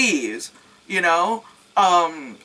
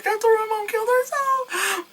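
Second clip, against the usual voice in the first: mock character voice. Quoting the movie character's line.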